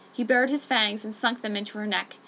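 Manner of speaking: plain reading voice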